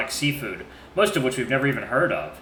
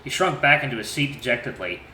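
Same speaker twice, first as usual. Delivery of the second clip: usual speaking voice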